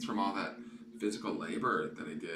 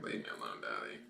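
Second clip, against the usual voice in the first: lower, grumpy cat voice; voicing the cat; 9 semitones lower, 6 dB quieter, faster than usual. Owner voicing her cat, grumbling at Daddy, low. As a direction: speak for the kitten, low and put out. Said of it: "He's like".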